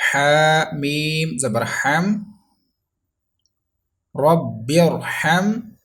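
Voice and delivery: slow, loud Quran spelling-out, syllable by syllable; slow spelling drill. Shown as a demonstration. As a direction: spell each letter slowly for pupils to repeat. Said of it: "Revise after me".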